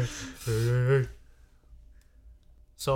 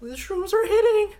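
Shaggy voice; 6 dB louder than usual. Retold or imitated. Shaggy voice continues.

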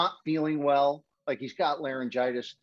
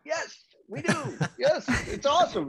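eager reply; 13 semitones higher than usual. Retold or imitated. His own eager reply, quoted.

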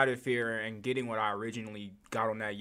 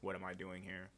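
much softer, almost under the breath; quiet question; 5 semitones lower, 15 dB quieter than usual. Acted. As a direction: ask it hushed and unsure.

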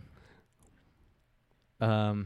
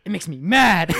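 loud high bully whine; bully whining angrily; mock bully; 6 semitones higher, 9 dB louder than usual. Retelling a sketch bully complaining, loud high whine.

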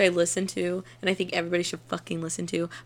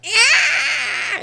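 high piercing mock scream; reenacting the girl's shriek; 7 dB louder than usual. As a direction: shriek like a failed power blast. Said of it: "She literally went".

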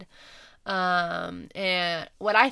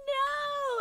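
anguished no. Retold or imitated. Retold reaction to sad scene.

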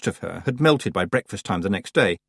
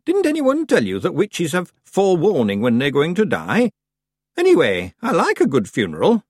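put-on old witch voice; 8 semitones higher, 4 dB louder than usual. A narrator's reading.

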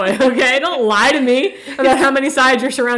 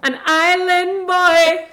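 put-on slow, stretched island boy; drawn-out punchline; 5 semitones higher, much slower than usual. Boyfriend labels everyone, slow drawn-out punchline. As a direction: savor each word slowly. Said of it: "he would go".

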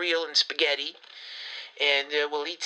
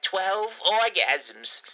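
drawn out; 3 semitones higher, much slower than usual. Acted. Stunned repetition of the claim.

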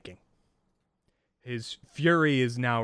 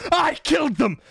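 screamed rage, much higher and louder; furious outburst; 9 dB louder than usual. Acted. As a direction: explode with fury, yelling every word. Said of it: "Fury is in his eyes".

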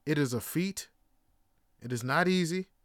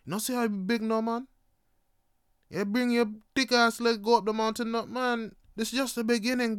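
higher-pitched Jamaican trail heckler; Jamaican accent; 5 semitones higher than usual. Retold or imitated. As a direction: imitate a local warning in Jamaican accent. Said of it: "It's like".

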